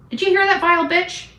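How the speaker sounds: ordinary delivery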